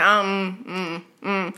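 low, soft noncommittal humming; quiet, hesitant mm sounds; reluctant mumble. Retold or imitated. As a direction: imitate a husband hedging without words. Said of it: "He'd be like".

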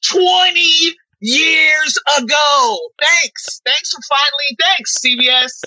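high, slow, half-crying mock complaint; sarcastic outburst. Real, not acted. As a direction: wail it out sarcastically.

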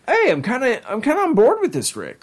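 grudgingly won over; imitating Jerry. Jerry admitting he likes Doofus Rick, high and won over. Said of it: "Jerry's kinda all like".

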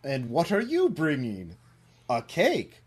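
lilting, slightly hammed-up critter lines; lightly voiced cartoon animals, pitch swooping up; cartoon animal chat. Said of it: "they sing and dance about this".

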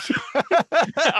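sudden high-pitched laugh; burst of laughter. Real, not acted. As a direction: laugh out loud, delighted. Host laughing at the entrance.

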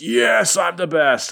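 high, triumphant crowing; mock victory boast, pitched high; boastful jungle hero. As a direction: crow it like a proud jungle hero. Kazar boasting after beating plant.

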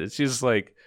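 ordinary talk, nothing marked

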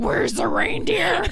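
very high, slow, wild mom voice; crazy voice. Retold or imitated. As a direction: wail it like a deranged demand. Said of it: "my mom's mask and".

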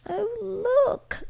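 very high, wailing exclamation; crying out. A narrator's reading. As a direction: cry out high, drawn out and moved. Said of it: "cried Missus Troy".

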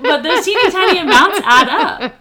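laughing while explaining; talking through giggles. Real, not acted. Laughs through her point.

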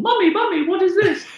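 put-on squeaky six-year-old asking his mum; high little child voice. Child calling to his mum from the car seat, high and excited. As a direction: pitch right up like a small boy.

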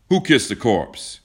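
loud, slow, stern demand; obvious disapproval. A narrator's reading. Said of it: "in obvious disapproval".

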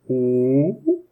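hoarse low ooh at the bottom of his range; low note. Shown as a demonstration. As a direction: sing a low rough ooh. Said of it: "I'm gonna start low".

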